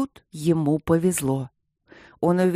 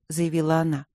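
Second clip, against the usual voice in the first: ordinary delivery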